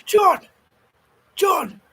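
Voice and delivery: high, loud mock-British call. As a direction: call out a name as fancy gentry. Calling out in posh British character.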